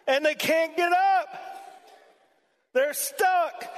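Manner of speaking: high, pressing sermon voice; fiery preaching. Acted. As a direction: preach it hard to the congregation. Preaching at full force.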